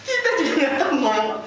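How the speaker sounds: high, loud half-laughing answer; emotional reply. Retold or imitated. Friend answering why he wept, high and loud. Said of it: "Ji, ente nangis kenapa?".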